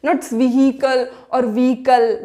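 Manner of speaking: व्हीकल said slowly as "vikal" with the h dropped; slow wrong-way demo. Shown as a demonstration. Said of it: "व्हीकल भी wrong है".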